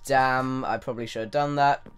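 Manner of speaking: ordinary delivery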